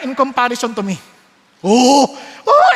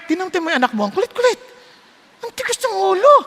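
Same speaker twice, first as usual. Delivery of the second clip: much higher scolding complaint; exasperated parent; 8 semitones higher, faster than usual. Retold or imitated. Parent complaining about the stubborn child, high and exasperated. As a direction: whine about the stubborn kid. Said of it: "pagka makulit".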